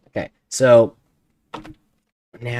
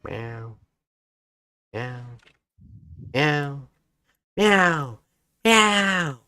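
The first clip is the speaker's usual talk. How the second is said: cat imitation; 7 semitones higher, 4 dB louder, slower than usual. Speaker mocking the noisy cat by meowing back, cat imitation. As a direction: mimic a meowing cat, each cry higher. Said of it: "That's what you sound like".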